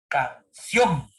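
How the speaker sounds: "canción" split in syllables, weight on "ción"; stress on the last syllable. Shown as a demonstration. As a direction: pause after "can", then lean on "ción". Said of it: "la fuerza de voz está en la última sílaba". ("canción" silabeado, con la fuerza en "ción")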